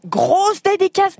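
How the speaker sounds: "Grosse dédicace" shouted high and slow; caps lock shout. Shown as a demonstration. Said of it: "comme je crie quand il y a des caps lock".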